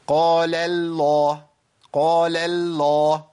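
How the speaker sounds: "قال الله" as "qaalal-lah", first l of "الله" dragged thin with "قال"; thin the lam into "الله", then thicken; slow chant, light "lal" before heavy "الله"; over-lightened lam. Shown as a demonstration. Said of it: "يسحب معاها أول لام من لفظ الجلالة".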